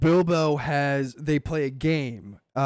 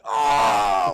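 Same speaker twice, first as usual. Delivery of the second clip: a sudden loud hurt "Oh!"; mock grunt of a hit monster; short shouted pain cry; 2 semitones lower, 6 dB louder than usual. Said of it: "like that".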